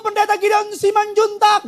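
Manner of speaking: high, loud, overly formal cry to father; mock ceremonial shout. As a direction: holler a grand title in mock formality. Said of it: "Dia nggak pernah teriak begini".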